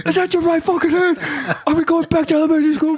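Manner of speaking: high, strained, fast-finishing tirade; imitated instructor berating. Instructor berating recruit over wrong hand, high mock yell. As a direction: berate him with voice pushed way up.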